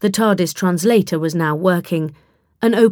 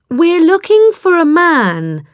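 slow, higher, louder, careful words; slowly; 10 semitones higher, 6 dB louder, slower than usual. Acted. Charlie addresses wary villagers, slow and careful. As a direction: spell it out for strangers. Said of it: "Charlie said slowly".

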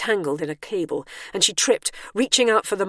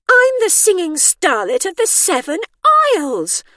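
high, loud wounded-diva voice; aggrieved; 13 semitones higher, 9 dB louder than usual. A narrator's reading. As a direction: proclaim it high and loud, deeply affronted. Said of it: "in an aggrieved tone".

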